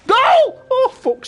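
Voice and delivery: huge high shout of "Goal!". Real, not acted. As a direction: yell it as the ball nears the net. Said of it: "sorry for shouting".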